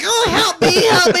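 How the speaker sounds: squeaky cry for help; Robin Williams bit. Retold or imitated. Guy mocking a friend as Robin Williams, squeaky plea. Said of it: "He's like".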